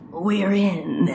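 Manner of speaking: low, drawn-out "we're in"; dramatic redo. Acted. Plumber repeats the line for effect, low and dramatic. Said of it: "Let me say that again".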